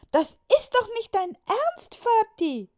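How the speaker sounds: put-on high, loud outraged child's cry; a boy shouting at his father in disbelief. High loud protesting child shouting. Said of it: "und Julius rief".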